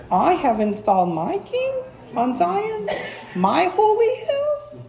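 timid, high-pitched questioning lilt; slow and halting, voice climbing very high as if asking; hammed-up meek, squeaky uncertainty; wrong tone. Shown as a demonstration. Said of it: "how do you think God is saying that".

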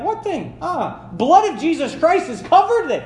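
high, bright mock God, delighted; joyful, high voice of God forgiving; God, cheerfully forgetting. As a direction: play God brushing the sin away happily. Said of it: "He's like".

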